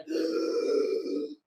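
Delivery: unvoiced mock wheeze for breath; put-on winded gasp; imitated gasping. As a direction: imitate someone already out of breath. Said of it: "first person goes like".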